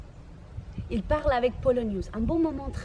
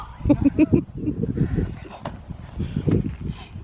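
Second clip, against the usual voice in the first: long loud breathy cackle; mocking laugh; 3 semitones higher, 6 dB louder than usual. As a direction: laugh scornfully at them. Laugh after rant.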